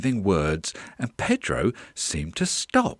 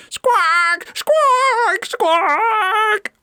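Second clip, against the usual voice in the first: piercing high, loud parrot squawks; shrieking parrot cry, repeated; 7 dB louder, much slower than usual. Acted. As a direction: squawk like a noisy bird. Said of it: "Pedro's squawks".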